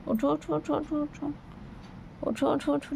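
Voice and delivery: coaxing a sleeping pet awake, high and soft. Real, not acted. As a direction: gently coax the pet awake, voice lifted and hushed.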